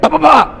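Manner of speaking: high, rapid-fire "bah" punch noises; imitated punches. Storyteller acts out the fight, imitated punches. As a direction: punch out each syllable high, like blows landing.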